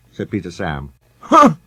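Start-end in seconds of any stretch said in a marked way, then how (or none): 1.2-1.6 high, loud scornful huh; loud grunt. A narrator's reading. Sir Handel grunting in scorn at Peter Sam. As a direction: snort out a disdainful grunt. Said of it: "grunted Sir Handel".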